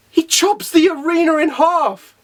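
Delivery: high, slow exclamation of disbelief; amazed emphasis. Real, not acted. Amazed first telling of the feat.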